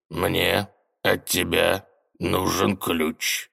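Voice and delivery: slow, low villain threat; slow theatrical villain menace. Acted. Тень угрожает министру. (медленно, зловеще)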